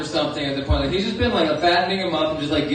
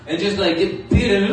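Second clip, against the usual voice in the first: normal talking voice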